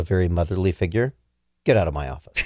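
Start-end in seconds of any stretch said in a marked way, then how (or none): 1.6-2.4 low, gruff boss telling someone off; lightly voiced stern boss; angry boss. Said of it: "That's not how she'd say it".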